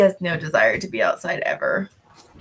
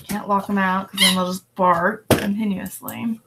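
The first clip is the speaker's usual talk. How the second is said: normal talking voice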